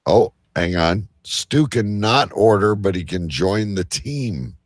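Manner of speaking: ordinary delivery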